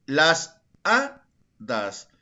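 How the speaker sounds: very slow "hadas", split after a strong A; syllable by syllable, lift the A in "hadas"; stress on HA. Shown as a demonstration. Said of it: "Mayor fuerza de voz en la A". ("hadas" muy lento y silabeado, marcando la primera A)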